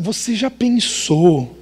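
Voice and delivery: slow, drawn-out teasing question; suspenseful. Retold or imitated. Dad floats an idea to his daughter, suspenseful. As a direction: dangle the idea slowly.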